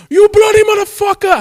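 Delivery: put-on high, loud Indian-accented cursing; hammed-up Indian outrage; angry Indian accent. Imitating scammers getting angry, mock Indian accent. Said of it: "I love the way they get angry".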